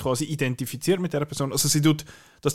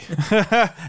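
burst of laughter; 8 semitones higher, 6 dB louder than usual. Real, not acted. Laughing at TTS playback.

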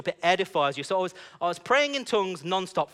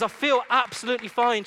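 higher, louder, emphatic delight; amazed relief; 7 semitones higher, 5 dB louder than usual. Retold or imitated. Woman telling him the prayer worked, amazed. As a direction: exclaim it in happy disbelief.

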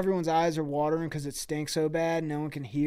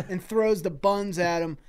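ordinary talk, nothing marked

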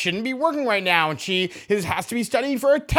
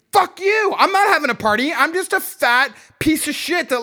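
angry outburst; 6 semitones higher than usual. Real, not acted. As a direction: bite back hard at the teasing. Rant reply to cashier.

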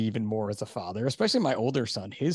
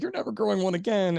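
imitated wife, higher-pitched and adamant; wife's objection; 10 semitones higher, 4 dB louder than usual. Wife forbidding husband another mustache, mock-adamant. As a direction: put her foot down in a higher voice.

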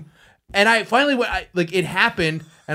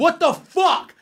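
outraged; 11 semitones higher than usual. Retold or imitated. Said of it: "I was just like".

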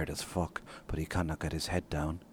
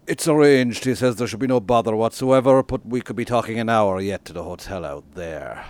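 much louder and higher driver's voice, a bit fast; 6 semitones higher, 14 dB louder than usual. Acted. Author voices the taxi driver reassuring John, loud and higher. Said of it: "Driver has a very smooth timbre".

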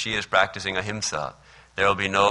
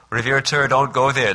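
loud, higher anxious plea; urgent warning; 5 semitones higher, 6 dB louder than usual. Acted. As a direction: call out to stop him. Boys warn holy man.

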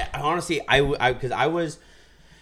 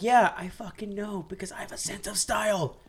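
sarcastic retort; 8 semitones higher, 3 dB quieter than usual. Retold or imitated. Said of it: "I'm like".

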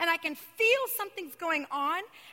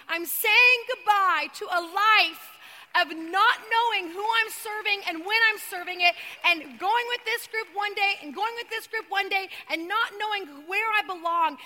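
building preaching; 3 semitones higher, 6 dB louder than usual. Acted. To the congregation, preaching louder and louder about leaving compromise. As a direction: swell into a full-voiced sermon climax.

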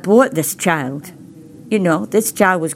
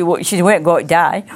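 normal talking voice

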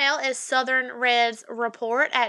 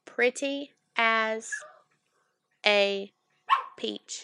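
"pretty as... a... peach" very slowly, long gaps between words; 2 semitones lower, 4 dB quieter, much slower than usual. Shown as a demonstration. Co-host showing careful Southern diction, "pretty as a peach" word by word. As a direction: drag out "pretty as a peach", pausing before "a" and before "peach". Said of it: "But you have to say it".

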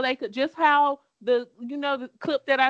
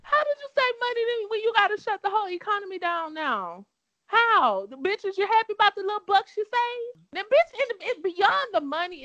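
calm turning angry; 8 semitones higher than usual. Real, not acted. Ranting at the president.

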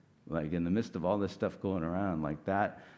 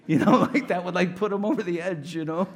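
laughing; 10 semitones higher, 8 dB louder than usual. Real, not acted. Laughing at himself.

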